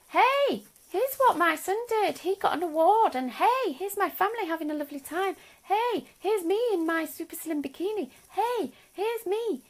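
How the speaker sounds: high, swooping sing-song show-off; mock-gushing, lilting high voice; chirpy social-media sharer. Mimicking boastful positive Facebook posts.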